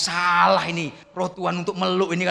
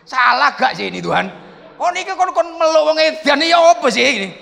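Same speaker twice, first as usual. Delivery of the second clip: high, loud retold protest to God; exasperated protest; 7 semitones higher, 7 dB louder than usual. Pastor questioning God about hugging a patient, exasperated. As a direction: plead in loud, mock disbelief.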